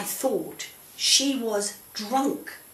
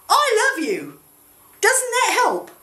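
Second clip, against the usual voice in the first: hammed-up husband voice, pitched much higher and louder; husband cheering her up; 13 semitones higher, 6 dB louder than usual. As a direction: coax her brightly, like a doting husband. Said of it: "Elkanah would say".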